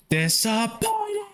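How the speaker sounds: drawn-out, disappointed meme voice; Kevin Sorbo meme. Retold or imitated. Streamer to chat, mimicking the Kevin Sorbo meme slowly. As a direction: stretch it out like the sad meme. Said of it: "the Kevin Sorbo meme".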